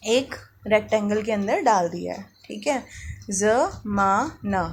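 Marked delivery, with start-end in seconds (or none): 3.3-4.6 ज़माना said very slowly with a z sound; slow teaching demo of ज़माना; slow sounded-out word. Shown as a demonstration. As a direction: stretch ज़माना with a buzzing z. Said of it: "क्या बन गया?".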